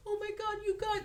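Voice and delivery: high, hammed-up upset cry; mock distraught wail. On the work bus, imitating crying coworkers, high mock wail.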